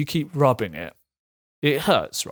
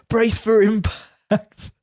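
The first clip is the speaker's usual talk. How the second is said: bracing for impact; 8 semitones higher, much slower than usual. Retold or imitated. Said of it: "You're like".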